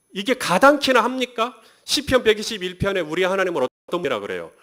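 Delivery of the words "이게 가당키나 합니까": indignant disbelief. Real, not acted. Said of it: "rhetorical indignant question".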